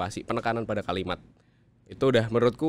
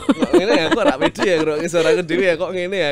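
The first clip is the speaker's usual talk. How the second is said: high, loud self-doubting mutter; a singer cringing at their own recording; 7 semitones higher, 11 dB louder than usual. Retold or imitated. A singer hears their own recording, doubting and high. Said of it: "dengerin rekamannya kayak".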